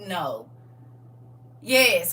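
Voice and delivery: ordinary talk, nothing marked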